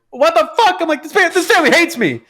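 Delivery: put-on high, indignant child squeal; outraged kid. Kid protesting to his mom in a high squeal.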